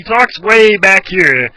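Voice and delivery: slow, high, loud, tongue pulled back; bowl the tongue and talk from way back; Nick Riviera. Shown as a demonstration. Showing viewers where the doctor voice sits, tongue back. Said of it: "he's pulling his tongue back here".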